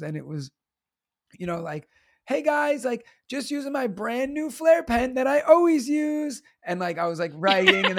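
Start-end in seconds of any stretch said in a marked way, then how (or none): 2.3-6.4 high, perky social-media host voice; chirpy vlogger pitching his favorite pen; influencer voice. Acted. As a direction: sell it like a bubbly lifestyle influencer. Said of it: "teacher influencer life videos".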